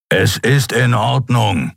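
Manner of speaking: slow, grumbling reassurance; rumbling growl. A narrator's reading. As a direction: grumble it out slowly in a creature voice. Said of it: "versicherte er grollend".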